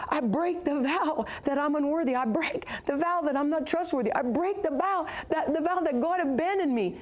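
high, insistent prayer declarations; fervent renunciation. Retold or imitated. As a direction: re-enact breaking vows in fervent prayer. Re-enacts her declarations in prayer.

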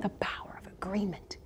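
hushed aside. Real, not acted. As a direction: drop into an emphatic whisper. Hushed aside after declaration.

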